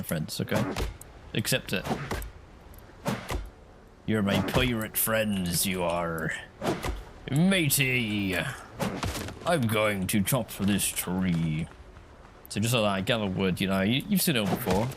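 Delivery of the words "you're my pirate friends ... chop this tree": put-on slow, drawn-out Somerset pirate accent. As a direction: play a West Country pirate, stretching the words. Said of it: "Your pirate accent is a Somerset accent".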